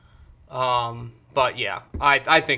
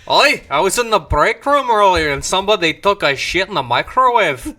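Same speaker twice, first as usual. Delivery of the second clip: put-on Scottish accent; 5 semitones higher, 6 dB louder than usual. Imitating Paul with Scottish accent.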